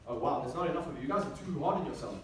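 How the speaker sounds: ordinary delivery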